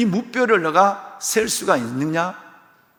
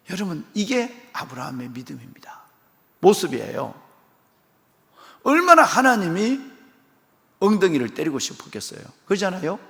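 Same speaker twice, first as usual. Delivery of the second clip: usual speaking voice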